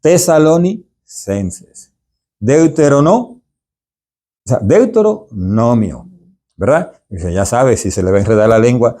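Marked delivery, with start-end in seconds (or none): 0.0-1.9 Tesalonicenses said very slowly, syllable by syllable; slow careful demo. Shown as a demonstration. Teacher shows readers a hard word, very slowly. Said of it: "usted debe pronunciarla varias veces o dividirla en dos". 2.4-6.1 Deuteronomio said slowly, part by part after a false start; split into parts. Shown as a demonstration. Teacher shows how to divide a hard word, slow. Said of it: "dividirla en dos para poderla pronunciar bien".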